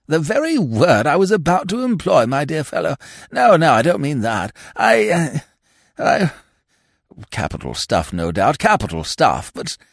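stammering peer backtracking in embarrassment; blurt, then stumble as his lordship; flustered lord. A narrator's reading.

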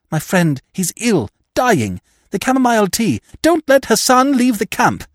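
breathless alarm, climbing in pitch; urgent panic. A narrator's reading. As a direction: blurt it out, desperate to save his friend. Hastings begs doctor, friend dying.

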